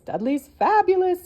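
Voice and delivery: hens chorusing. Acted. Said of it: "chorused all the hens".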